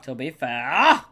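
drawn-out "To be fair" with "fair" swung up loud; mock echo. Co-host echoes the other's "To be fair", slow mocking singsong. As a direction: stretch "To be fair", lifting hard on "fair".

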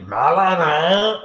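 slow, drawn-out robot voice. Pretend. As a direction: drone it like a machine. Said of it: "in his robot voice".

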